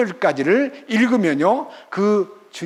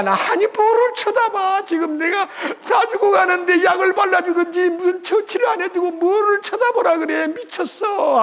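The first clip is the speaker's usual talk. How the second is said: whining bitten man; 12 semitones higher than usual. Retold or imitated. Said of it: "상처만 쳐다본 사람은".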